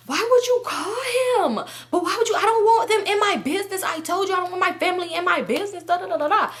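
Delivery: very high whining protest; mock upset friend. Friend objects to him being called, high mock outrage. Voicing friend angry about the call.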